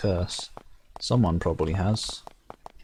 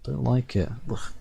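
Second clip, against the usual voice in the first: ordinary talk, nothing marked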